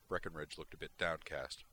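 ordinary delivery

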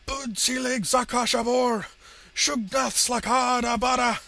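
high, slow, very loud summoning cry; shouted incantation. A narrator's reading. Villain calls on his destroyed monster, desperate shouted incantation. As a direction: bellow each word of the spell, drawn out.

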